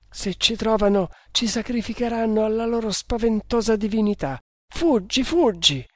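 high-pitched, panicked pleading; broken voice. A narrator's reading. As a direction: plead for flight in rising alarm. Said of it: "proseguì la giovanetta con voce spezzata". (supplica spaventata, voce acuta e spezzata)

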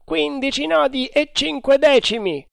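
high, loud call-out; sailor's shout. A narrator's reading. A sailor calling the speed to the crew, shouting. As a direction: sing out the speed. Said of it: "gridò dopo qualche minuto".

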